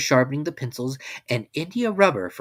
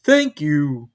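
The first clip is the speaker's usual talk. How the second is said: growled; 4 semitones higher, 9 dB louder than usual. A narrator's reading. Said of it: "growled Pooh".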